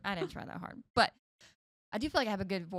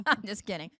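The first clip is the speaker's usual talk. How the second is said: ordinary talk, nothing marked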